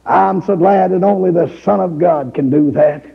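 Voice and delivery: normal talking voice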